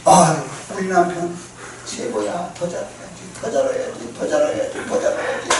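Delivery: a wife gushing over her husband, trailing off; delighted wife. Retold or imitated. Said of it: "더 잘하고 싶어요". (남편을 칭찬하며 점점 작아지는 목소리)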